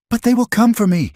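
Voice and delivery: high, frantic cry of fear; frightened cry. A narrator's reading. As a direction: cry it out in panic. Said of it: "she cried".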